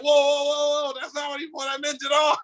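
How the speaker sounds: high, frantic denial; mock panicked backpedal. Himself backpedaling after being misquoted, high and panicky. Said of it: "And then you're like".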